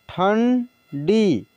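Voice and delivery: ठंडी drawn out very slowly, sound by sound; slow classroom dictation of ठंडी; slow spelled-out reading. Shown as a demonstration. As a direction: stretch ठंडी slowly for the learners. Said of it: "teacher reads word slowly".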